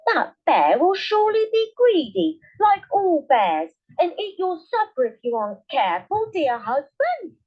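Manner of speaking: a wife warning her husband in alarm; the wife warns the woodman about the greedy bear; worried wife. A narrator's reading. Wife voiced in story.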